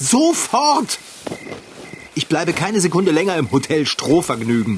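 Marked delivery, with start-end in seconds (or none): 0.0-1.0 high insistent shout. Acted. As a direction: snap it out firmly. Insists on leaving now.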